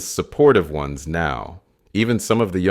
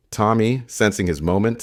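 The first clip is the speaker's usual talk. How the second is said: plain reading voice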